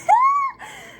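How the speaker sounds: very high shrieking laugh; piercing squeal of laughter; cackle. Real, not acted. Laughs after exclaiming.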